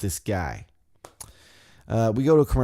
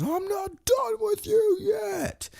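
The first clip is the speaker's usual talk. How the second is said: high, slow booming threat; menacing shout; 3 dB quieter, slower than usual. Retold or imitated. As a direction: Braun calls after the fleeing Elias, slow hammed-up shout. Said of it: "Braun stops and goes".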